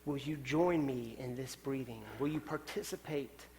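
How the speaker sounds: a hushed, tender call to join in; gentle voice of the Spirit. Pretend. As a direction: speak as the Spirit, close and unhurried. The Spirit speaking to the congregation.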